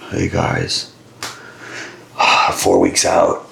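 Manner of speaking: slow, breathy, sighing and drained; imitated dying exhaustion. Older competitor greets the booth four weeks out, acting exhausted. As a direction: barely get it out, all air and no voice. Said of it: "like, just dying".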